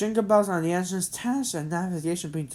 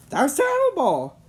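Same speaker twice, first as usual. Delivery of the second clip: high, loud shocked exclamation; dismayed cry; 12 semitones higher, 7 dB louder than usual. Acted. Head elf on the phone, shocked loud cry. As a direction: react to dreadful news aloud. Elf hears bad news.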